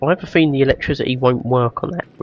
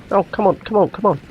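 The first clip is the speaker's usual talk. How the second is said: high, pressing come on; impatient urging; 8 semitones higher than usual. Real, not acted. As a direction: urge it on, pitched up. Frantic waiting mid-battle.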